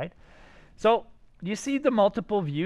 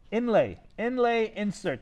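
"Inlay, inlay" said slow and higher, "insert" dropping; spelled out slowly; 4 semitones higher than usual. Shown as a demonstration. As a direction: drill the words "Inlay, inlay, insert" slowly for the class. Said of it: "Those are the two words I want you to think about".

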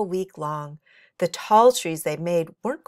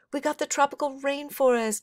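bright, higher happy announcing; happy announcement; 9 semitones higher than usual. A narrator's reading. Kenny tells his friends the news, happily announcing. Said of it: "Kenny announced happily".

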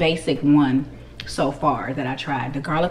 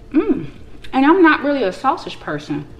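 talking while chewing food; speaking between bites; mouth full, chewing; 9 semitones higher, 4 dB louder than usual. Real, not acted. Seen 1: bit food at -1.0; jaw working, cheeks full early on.

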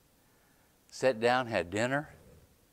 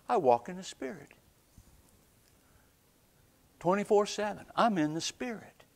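higher and higher faith confession; rising preaching declaration; 8 semitones higher than usual. Acted. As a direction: build the confession, climbing in pitch.

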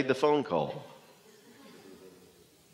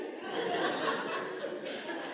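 breathless, voiceless wheeze of a laugh; silent laugh; 14 dB quieter than usual. Real, not acted. Laughing at his own shock, a voiceless wheezing laugh. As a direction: shake with a soundless laugh. Laugh after telling the news.

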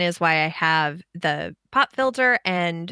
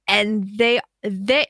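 ordinary talk

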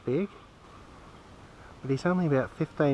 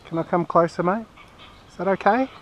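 higher, gentle coaxing voice to an animal; coaxing pet talk; 5 semitones higher than usual. Real, not acted. Man asks the kookaburra if he can come closer, higher coaxing pet talk. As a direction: sweet-talk the bird closer.

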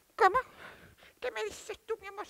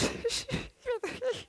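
short laugh; 6 semitones higher, 8 dB quieter than usual. Acted. Amused by plant's demand.

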